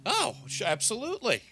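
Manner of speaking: high, eager agreement; obliging innkeeper, pitched high; eager barkeep. Acted. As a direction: jump to serve a customer, voice shooting up. Barkeep eagerly takes the order.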